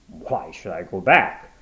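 puzzled Watson. Acted. Watson asking the woman why, bewildered. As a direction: ask it slowly in confusion.

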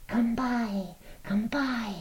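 high, soft coaxing goblin call; goblin cry. A narrator's reading. Goblins offering fruit to Laura, high coaxing cry. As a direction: coax her softly like a goblin. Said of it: "was still their cry".

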